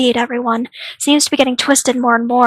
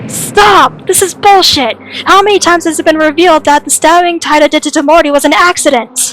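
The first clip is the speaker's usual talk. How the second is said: loud, heated protest; angry outburst; 3 semitones higher, 5 dB louder than usual. Acted. Defending Taira against the accusation, angry and loud. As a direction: burst in and shout the argument down.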